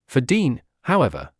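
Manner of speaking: ordinary delivery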